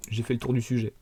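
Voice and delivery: usual speaking voice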